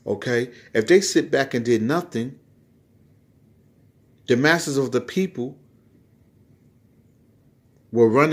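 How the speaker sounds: loud, driving sermon delivery; full-force preaching. Acted. Pastor rousing the congregation about the chip, loud preaching.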